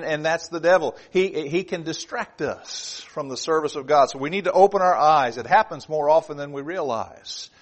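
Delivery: ordinary delivery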